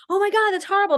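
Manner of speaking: high, loud alarmed gasp of horror; panicked reaction. Pretend. Friend imagines reacting to the flood news in high panic. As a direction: shriek it out as if the news is a disaster.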